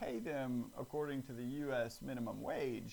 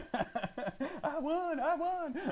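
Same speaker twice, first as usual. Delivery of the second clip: excited squeal; 14 semitones higher, 4 dB louder than usual. Pretend. Jokingly celebrating a fake prize.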